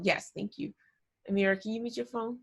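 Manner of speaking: usual speaking voice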